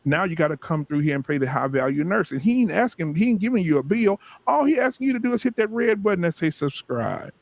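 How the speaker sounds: climbing sermon cadence; rising impassioned oratory. Acted. Rising sermon-style pitch.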